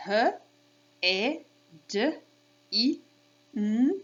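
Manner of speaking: H, E, D, I, N as separate short speech sounds; sounded out. Shown as a demonstration. Teacher counting sounds for kids, sounded out. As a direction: say H, E, D, I, N by sound, with gaps. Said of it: "so we'll do it again".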